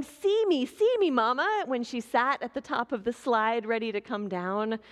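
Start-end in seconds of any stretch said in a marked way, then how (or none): 0.0-1.6 high child's calling-out voice, mildly mock; child shouting. Little daughter calling to her mother at the park, high mock shout. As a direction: call up from the top of the slide. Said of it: "her daughter kept shouting".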